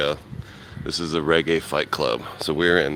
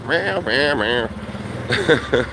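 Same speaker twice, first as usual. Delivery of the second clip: high playful meow at the cat; mock meowing; 9 semitones higher, much slower than usual. Imitating the cat back.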